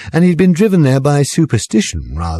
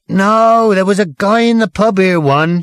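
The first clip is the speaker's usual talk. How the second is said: mildly louder barman shouting back; 9 semitones higher, 3 dB louder than usual. A narrator's reading. Barman shouting back across the pub to the barmaid. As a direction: call back across the bar. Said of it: "shouted back the barman".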